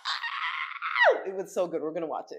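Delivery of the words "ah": high thrilled shriek; excited scream. Retold or imitated. Reliving concert excitement.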